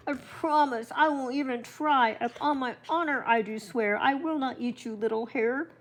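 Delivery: grandma voicing the fox swearing to the hare; fox near tears. A narrator's reading. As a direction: promise it like a fox about to cry. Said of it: "The poor fox looked about to cry".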